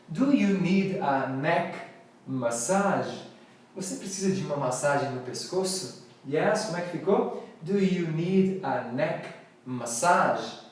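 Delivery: usual speaking voice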